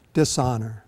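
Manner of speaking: the word dishonor pushed out with weight; emphasis on dishonor. Shown as a demonstration. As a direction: lean hard on dishonor. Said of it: "put the emphasis on the dishonor".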